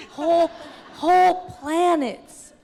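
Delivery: excited. Retold or imitated. Quoting exec's excitement.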